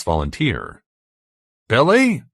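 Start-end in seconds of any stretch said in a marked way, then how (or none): 1.7-2.2 high, loud questioning shout; shouted call. A narrator's reading. Narrator calling after Billy over the arch, high shout. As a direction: call out for someone out of sight. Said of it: "I shouted".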